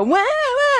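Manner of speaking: high mock toddler wah-wah whine; toddler whining. Mother mimics a toddler whining, high mock whine. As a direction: squeal it up high like a whiny toddler. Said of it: "and they're whining".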